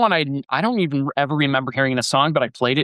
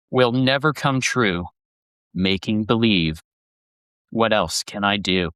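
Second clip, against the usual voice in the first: slow, lower, wistful reading with long pauses; melancholy lyric recitation; 5 semitones lower than usual. Acted. Host reciting [name] lyrics for listeners, slow melancholy reading. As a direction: read it like a sad poem, letting each line hang.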